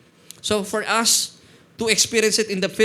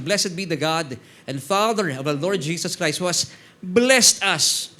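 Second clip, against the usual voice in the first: slow, solemn reading that swells louder on blessed us; scripture reading; 3 dB louder, slower than usual. Acted. Pastor to church, reading Ephesians slowly and solemnly. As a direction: read the verse with weight, stretching the last words. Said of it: "reading scripture aloud".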